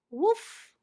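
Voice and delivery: high yippy woof; a quick excited dog woof; dog bark. Acted. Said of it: "barks Rex".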